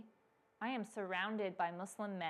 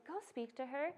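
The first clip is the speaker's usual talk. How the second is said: soft, slightly raised gentle prompting; still quiet voice; 4 semitones higher, 7 dB quieter than usual. Retold or imitated. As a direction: nudge softly, like an inner voice. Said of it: "that still, quiet voice".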